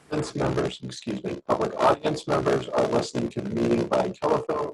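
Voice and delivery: choppy, garbled call audio; bad connection. Real, not acted. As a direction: voice breaking up over the line. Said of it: "Sounds like a really bad connection".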